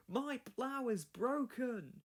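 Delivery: high, slow dismay. Acted. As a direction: mourn the broken plough slowly. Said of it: "explained Thomas".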